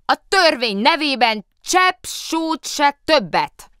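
slow, high, loud proclamation; official shout. A narrator's reading. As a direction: bellow it in the name of the law. Muki shouts back, official.

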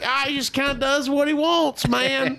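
lightly voiced unbothered owner; casual monkey owner. Owner brushing off a worried guest, casual dude voice.

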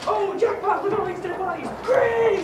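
high, excited gloating; hammed-up treasure-finder's delight; mock glee. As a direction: build to a loud gleeful cry. Imitates gleeful grave robber.

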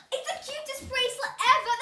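high squealing gasp, then fast gush; thrilled by the cutest gift; delighted gasp. Real, not acted. Opens bracelet.

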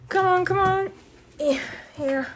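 normal talking voice

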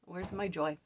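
ordinary delivery

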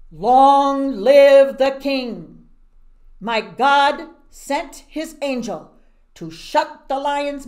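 slow, loud, ringing proclamation; Daniel calling up to the king from the den; triumphant Daniel. Acted. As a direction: announce it like a victory from the pit.

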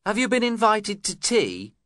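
high, sharp cross question; crossly. A narrator's reading. Young Mrs Heathcliff to the uninvited guest, cross. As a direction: snap the question at the unwanted guest. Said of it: "she asked me crossly".

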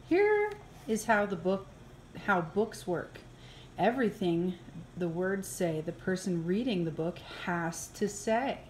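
ordinary delivery

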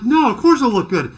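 loud, high, mock-exasperated answer; hammed-up incredulous comeback; sarcastic retort. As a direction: throw it back loudly, laughing. Retorts to boss's doubting question.

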